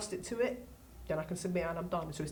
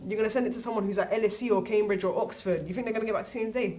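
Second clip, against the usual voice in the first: ordinary talk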